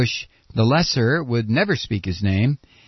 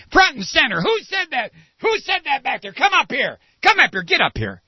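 higher, barking orders at a recruit; drill sergeant bark; 10 semitones higher than usual. Acted. Drill sergeant ordering a heckler to the front, barking.

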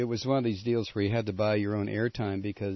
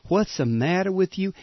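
mock Southern accent; 8 semitones higher than usual. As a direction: imitate her fussing Southern accent. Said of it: "She talked like this when she showed up".

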